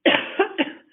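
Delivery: sharp dry cough; loud cough mid-sentence. Real, not acted. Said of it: "sorry, I'm coughing".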